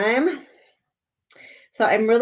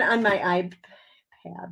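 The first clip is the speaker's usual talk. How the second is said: ordinary delivery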